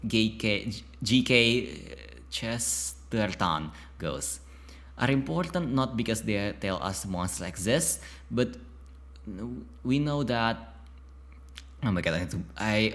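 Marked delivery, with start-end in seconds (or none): none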